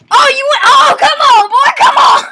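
high, loud taunt; taunting shout. Real, not acted. Gamer loudly taunting the other player. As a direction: egg him on, jeering.

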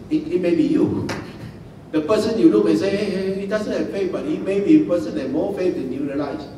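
usual speaking voice

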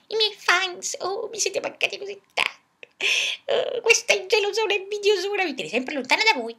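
high gushing diva voice; diva puppet voice. Acted. Puppet greets her fans, high and gushing. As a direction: gush to the fans like a spoiled star.

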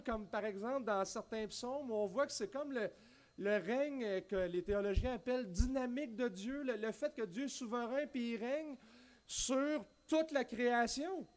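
ordinary talk, nothing marked

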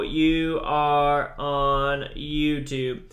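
ordinary talk

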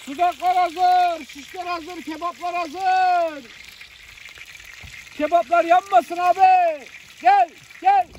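playful street-crier call. Pretend. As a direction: holler that food is ready, stretching the word endings. Calling everyone to eat.

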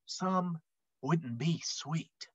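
hushed. A narrator's reading. Unkind people in story.

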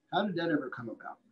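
usual speaking voice

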